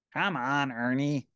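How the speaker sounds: plain reading voice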